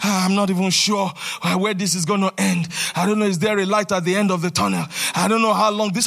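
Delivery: low, fast, defeated mumble; mock despairing self-talk, muttered low and quick; mocking a doubter. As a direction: imitate a hopeless believer, rushing and small. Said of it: "How dare you say things about yourself?".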